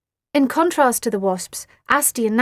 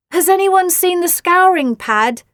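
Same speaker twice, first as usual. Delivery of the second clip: higher, louder called out; shouting from the kitchen; 8 semitones higher, 8 dB louder than usual. A narrator's reading. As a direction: call from another room. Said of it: "shouted Mum from the kitchen".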